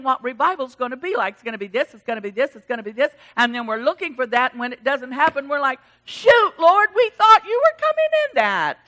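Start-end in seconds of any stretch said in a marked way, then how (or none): 6.0-8.8 high, loud wail of complaint; hammed-up disappointed whine; mock dismay. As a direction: protest to heaven, high and loud. Voices disappointed believers to God.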